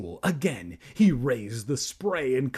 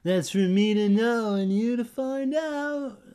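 sneering; 10 semitones higher, 5 dB louder than usual. A narrator's reading. Andy refuses to tell Evan, sneering in a high voice. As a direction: sneer it like a playground tease. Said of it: "She sneered".

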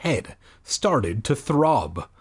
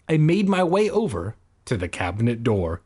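usual speaking voice

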